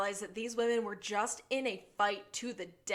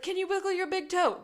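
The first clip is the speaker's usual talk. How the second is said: mock anxious, higher pitched; anxious Gus; 9 semitones higher, 5 dB louder than usual. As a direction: fuss over him in a hammed-up high voice. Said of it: "And Gus is like".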